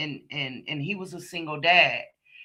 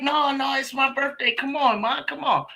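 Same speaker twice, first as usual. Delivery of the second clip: higher pitched imitation of a whiny plea; mock pleading boyfriend; 8 semitones higher than usual. Boyfriend begging her to roll one for his birthday, high mock whine. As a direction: play him begging like a kid.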